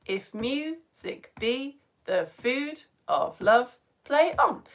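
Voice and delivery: slow, paired syllables, stressing the second: if MU-sic BE the FOOD of LOVE; slow di-DUM scansion of "play on"; iambic beat. Shown as a demonstration. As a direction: chant "If music be the food of love" in didum pairs. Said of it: "it's the second, uh, beat that is stressed".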